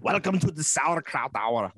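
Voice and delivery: mock German TV host accent; hammed-up German host greeting. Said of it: "a German Home Shopping Network thing".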